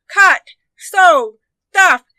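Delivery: ordinary delivery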